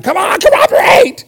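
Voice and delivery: high, loud, slow frustrated yell; yelling at tongue. Retold or imitated. Preacher yells at his tongue while reading, hammed-up loud shout. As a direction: bark it at your own stubborn tongue. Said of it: "I was ready to yank my tongue".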